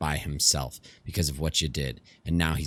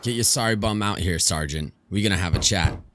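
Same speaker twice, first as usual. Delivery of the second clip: raised-voice demand, starting high; confrontational demand; 5 semitones higher, 4 dB louder than usual. Acted. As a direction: call someone out for a confrontation. Ajay banging on Bangalore's door.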